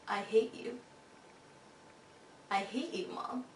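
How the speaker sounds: the same hateful words now spoken softly in remorse; remembered in regret. Retold or imitated. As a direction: let the words haunt you as you recall them. Recalling her last words, regretful.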